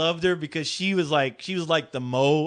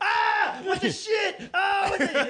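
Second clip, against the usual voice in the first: much higher shocked yelp; startled kids; 13 semitones higher, slower than usual. Retold or imitated. Kids seeing pop-up teeth, high shocked squeal.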